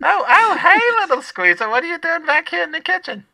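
very high, loud lunch lady; shrieking cafeteria lady greeting. Acted. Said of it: "I should just do both voices".

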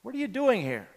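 "What are you doing here?" lifted high, stress on doing. Retold or imitated. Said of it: "repeat word for word what he had said before".

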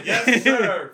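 very loud eager reply; loud yes sir. Acted. Acted assistant reply.

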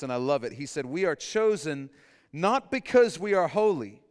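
ordinary talk, nothing marked

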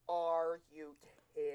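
slow, disbelieving Are you kidding; drawn-out mock outrage; incredulous. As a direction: food worker appalled. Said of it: "the food service person in me was like".

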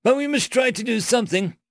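high, agitated insistence; flustered high-pitched urging; urgent plea. A narrator's reading. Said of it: "said Biograt".

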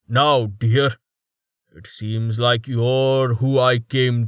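slow, smug and dismissive; a bully who blames others; arrogant brute voice. A narrator's reading. Thrust, smug demanding.

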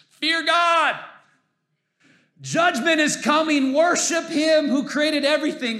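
high, loud mock angel shout; playing an angel shouting over the parking lot; angel yelling. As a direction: bellow it from the sky. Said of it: "he's yelling some loud words".

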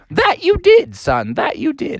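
high mom voice, easing down; high-pitched mom giving in; conceding mother. Acted. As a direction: admit it in a high motherly falsetto.

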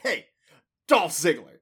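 loud, emphatic name announcement; punchy wrestler's handshake greeting; Dolph Ziggler. Retold or imitated. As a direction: introduce yourself with gimmicky force. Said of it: "you're saying it in that tone".

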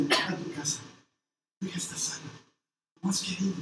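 soft, low, unhurried; gentle blessing. Retold or imitated. Jesus quietly telling the mother her daughter is healed. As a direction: send her home gently.

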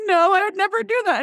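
high, far-off imitation of a daughter insisting; mock teen daughter. Mom imitating daughter Gabby at the dinner table, high mocking voice. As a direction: play the indignant teenage girl. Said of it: "And she goes".

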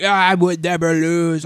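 hammed-up defiant boast; proud Dante. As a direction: declare it like an arrogant demon. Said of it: "he's like".

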